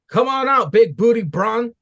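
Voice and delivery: hammed-up Trick shouting for Braun; calling-out Trick. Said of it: "Trick says".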